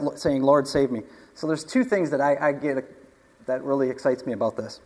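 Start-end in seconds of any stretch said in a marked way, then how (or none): none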